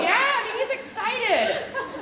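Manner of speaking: high, slow laughing words. Real, not acted. Host laughing at the toy in front of the guests. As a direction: giggle through it, still delighted.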